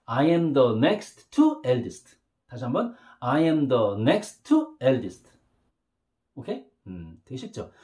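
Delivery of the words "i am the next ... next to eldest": slow model sentence. Shown as a demonstration. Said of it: "그런 경우 이렇게 쓰면 돼요".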